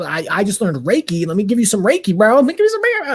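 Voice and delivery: rising, increasingly frantic pleading; mock panic, grasping at straws; panicked retelling. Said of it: "I'm, like, panicking".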